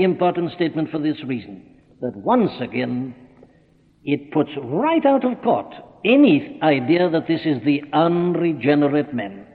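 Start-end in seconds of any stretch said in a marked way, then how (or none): none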